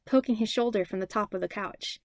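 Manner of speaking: usual speaking voice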